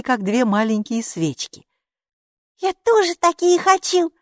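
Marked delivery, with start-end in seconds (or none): 2.6-4.1 high, loud little squeal; thrilled small-creature voice; delighted admiration. A narrator's reading. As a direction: gush with longing, pitched right up. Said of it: "с восхищением проговорила Майки".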